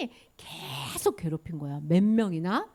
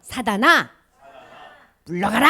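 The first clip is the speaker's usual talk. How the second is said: loud call-and-response phrases; firm declaration; 7 dB louder than usual. Shown as a demonstration. Teacher leading children with hand on heart, firm declaration. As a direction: declare it in short bursts for others to echo. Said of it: "자, 한번 해보세요".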